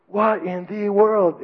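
high, drawn-out mock exclamation; a slow, cleaned-up sergeant's outburst; toned-down drill sergeant. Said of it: "he didn't say it like that".

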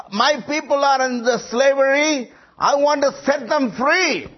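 high, ringing voice of God calling Moses. Acted. Preacher voicing God speaking to Moses, high and ringing. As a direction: proclaim it from above, slow in places.

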